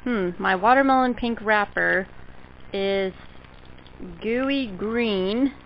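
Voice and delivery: usual speaking voice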